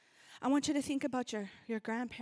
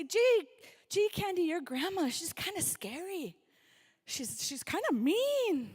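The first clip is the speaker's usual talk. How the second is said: teasing neighbours; 5 semitones higher, 7 dB louder, slower than usual. Retold or imitated. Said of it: "They used to say".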